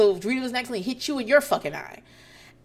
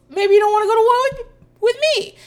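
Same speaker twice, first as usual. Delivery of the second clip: much higher, louder, fast mock comeback; hammed-up high squeal of a retort; overdone comeback; 13 semitones higher, 10 dB louder, faster than usual. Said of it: "I didn't perform them correctly".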